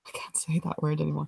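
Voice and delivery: embarrassed whispered confession. Real, not acted. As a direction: drop to a breathy whisper. Embarrassed aside after Dalek joke.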